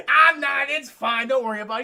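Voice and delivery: loud, laughing brush-off; laughing reassurance. Retold or imitated. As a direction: laugh it off loudly. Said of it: "He's like".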